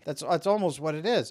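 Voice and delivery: ordinary delivery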